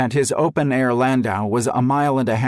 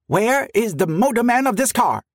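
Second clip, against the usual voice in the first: high, raised demand for the man responsible; 12 semitones higher than usual. A narrator's reading. President confronting the trolley crew, high demanding voice. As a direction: insist on an answer, voice up. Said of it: "he demanded".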